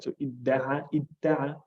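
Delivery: idda'a with d and t merged into one held dd; showing how the dal and ta assimilate; assimilated dal and ta. Shown as a demonstration. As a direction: let idda'a blend the d and t together. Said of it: "can you hear that the makhraj of the dal and the ta are so close".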